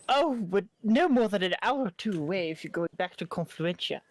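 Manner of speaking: game master attempting a female traveler; attempted female voice. Game master gives travelers directions, attempted female voice. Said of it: "my lack of ability to do a female voice".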